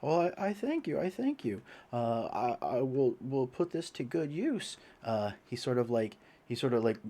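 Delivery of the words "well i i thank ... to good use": a hint of a frail old priest; higher, thankful old man. DM voices grateful old priest.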